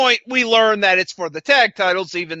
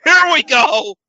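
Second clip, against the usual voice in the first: exasperated shout; 6 semitones higher, 5 dB louder than usual. Real, not acted. Dreading the next segment.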